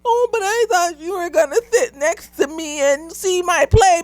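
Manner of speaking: put-on high, whiny little-kid voice; sing-song child asking you to stay. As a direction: mimic a pouty child begging. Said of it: "one of the little children in the corner come out talking about".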